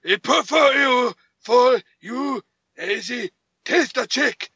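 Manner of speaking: loud, high, slow mock Japanese accent; hammed-up caricature of a Japanese accent; terrible fake Japanese accent. Mocking an actor's disguise in a sitcom-like scene, terrible Japanese accent. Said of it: "she had to do this accent".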